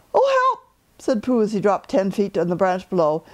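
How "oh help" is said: mock cry for help. A narrator's reading. Pooh falls out of tree.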